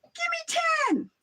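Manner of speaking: very high, loud squeal; mock shouting kid. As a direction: shriek the price up. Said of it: "That guy comes to me".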